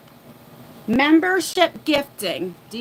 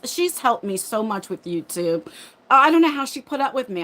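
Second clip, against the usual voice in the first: normal talking voice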